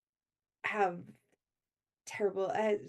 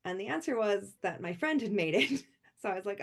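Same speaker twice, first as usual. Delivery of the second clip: ordinary talk, nothing marked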